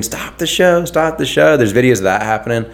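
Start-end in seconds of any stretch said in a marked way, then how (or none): 0.0-1.6 mock scream. Freaked-out festival crowd begging for a halt, mild mock scream. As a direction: mimic desperate chanting without full volume. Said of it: "They're screaming".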